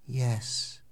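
a soft, barely voiced yes; very quietly. A narrator's reading. Jekyll quietly admitting the truth to Utterson, hushed. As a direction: admit it under the breath. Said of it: "he answered very quietly".

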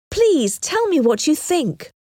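high-pitched, mildly agitated begging; urgent plea. A narrator's reading. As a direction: plead lightly for an opinion, pitch up. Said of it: "cried Harriet".

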